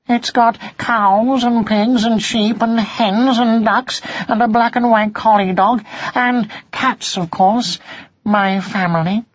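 small cat listing home proudly; kitten voice. Acted. Kitten describes the farm.

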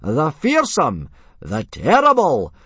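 slow, high, drawn-out ring announcement; enthusiastic yelling. A narrator's reading. Instructor presenting the chief's son, forced enthusiastic yell. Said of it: "trying to put a bit of enthusiasm into his yelling".

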